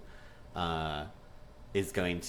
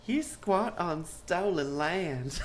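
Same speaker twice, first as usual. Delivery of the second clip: slow, high, drawn-out Southern drawl; imitated Southern accent; 9 semitones higher, much slower than usual. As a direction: mimic a Southern accent, amused and stretched out. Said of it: "I love this accent".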